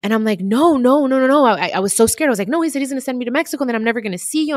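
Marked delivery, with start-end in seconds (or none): 0.4-1.5 high, loud frightened protest; scared pleading no. Retold or imitated. Retelling begging her friend not to tell, frightened. 2.5-4.5 high, fast, scared outpouring; frightened rush. Retold or imitated. Child scared of being sent away.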